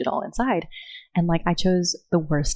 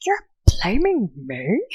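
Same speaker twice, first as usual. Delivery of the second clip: mock indignant; 3 semitones higher, slower than usual. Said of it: "he got so angry".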